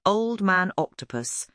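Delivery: plain reading voice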